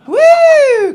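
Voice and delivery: loud, high triumphant woo; whoop. Acted. Celebratory whoop.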